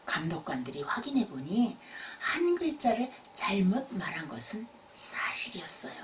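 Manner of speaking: ordinary delivery, nothing marked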